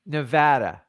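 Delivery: Nevada echoed with the A of "cat"; repeated correct pronunciation. Shown as a demonstration. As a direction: try out Nevada as nuh-VAD-uh. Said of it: "say it again".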